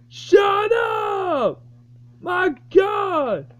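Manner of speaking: shrieking at the camera; shouting. Real, not acted. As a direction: yell at the person filming. Brother yelling at the camera.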